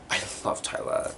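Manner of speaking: whispered aside, barely voiced. Real, not acted. Fan whispering to viewers about loving Tyler, whisper. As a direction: breathe it like a secret crush. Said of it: "whispered aside about Tyler".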